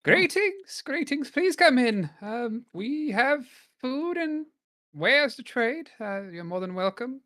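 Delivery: very high, loud welcoming merchant; squeaky shopkeeper greeting; high-pitched trader voice. Acted. GM voices trader inside fort.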